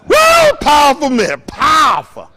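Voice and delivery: a happy churchgoer cheering the preacher after a sermon; excited church shout. Retold or imitated. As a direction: shout "Woo! Powerful" like a thrilled churchgoer.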